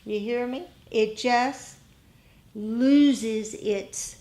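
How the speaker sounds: normal talking voice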